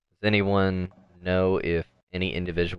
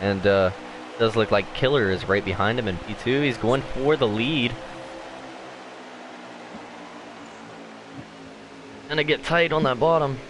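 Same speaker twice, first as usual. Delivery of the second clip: lively, raised play-by-play call; race commentary; 3 semitones higher than usual. Acted.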